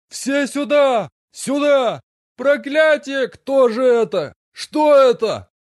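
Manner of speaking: a guard yelling in alarm from far off; panicked alarm cry. Acted. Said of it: "своим безумным криком поднял тревогу". (встревоженный безумный крик вдали)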